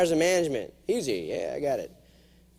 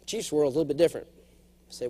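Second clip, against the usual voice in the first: ordinary delivery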